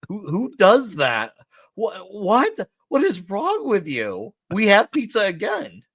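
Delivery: incredulous, high-pitched and drawn-out protest; hammed-up disbelief, voice climbing high; mock outrage. Pizza lover berating whoever refused pizza, mock outrage.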